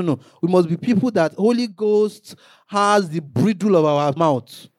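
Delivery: ordinary talk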